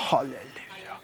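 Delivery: breathy awed whisper; a soft reverent whisper; whispered halleluja. Real, not acted. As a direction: breathe the word in awe. Whispered praise after story.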